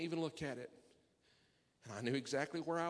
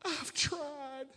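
a tearful plea, slowing at the end; crying prayer; 7 semitones higher, slower than usual. Retold or imitated. Preacher retelling his prayer to God, near tears. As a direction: voice breaking high as he pleads with God.

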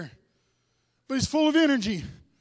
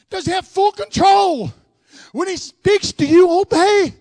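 louder, rising revival preaching; full-force preaching; 3 semitones higher, 8 dB louder than usual. Acted. Preacher urging the church toward revival, full-force preaching. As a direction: preach it hard to the congregation.

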